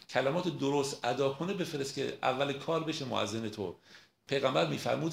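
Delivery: normal talking voice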